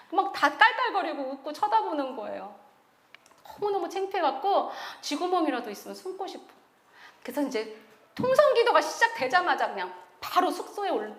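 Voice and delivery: normal talking voice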